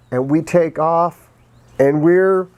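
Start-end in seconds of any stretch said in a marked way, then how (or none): none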